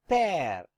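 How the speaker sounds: pear with open eh, as French "père"; open-vowelled pear, tune falling; correct French pronunciation. Shown as a demonstration. Teacher gives the example word, pear with open eh. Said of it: "this will be pronounced as".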